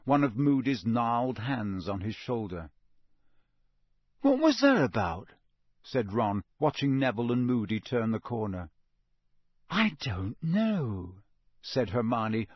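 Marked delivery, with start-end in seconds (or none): 4.2-5.3 high-pitched puzzled question; baffled. A narrator's reading. Boy watches friend led away, baffled. Ron baffled by teacher's invitation. 9.7-11.2 very slow, drawn-out musing; pensive. A narrator's reading. Girl puzzles over what happened, pensive. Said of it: "looking pensive".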